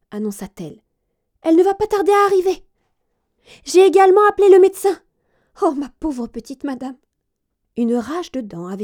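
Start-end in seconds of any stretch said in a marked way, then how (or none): 1.4-7.0 high, loud, panicked chambermaid; a servant trying to hide her alarm; breathless lady's maid reporting to her mistress; flustered maid. A narrator's reading. Maid voiced in story.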